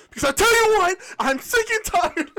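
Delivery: hammed-up furious sailor; mock angry captain. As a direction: rant like a fed-up sea captain. Voicing angry Danish captain.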